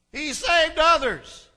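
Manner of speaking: high, loud, slow jeer; mocking shout with contempt; loud scoffing. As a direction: scoff it out publicly, drawn out. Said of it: "they scoffed".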